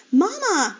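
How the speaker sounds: high, loud cry for mother; panicked screech. A narrator's reading. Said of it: "screeched Flora".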